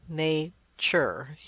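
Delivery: "Nature" split into two separate syllables. Shown as a demonstration. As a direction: say "Nature" in two distinct jaw movements. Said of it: "syllable occurs each time your jaw moves".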